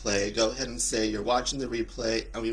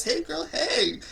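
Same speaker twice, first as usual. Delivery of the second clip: sassy high greeting; slower than usual. Real, not acted. Greeting a viewer in the live chat, high sing-song sass. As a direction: squeal the greeting up high like a diva.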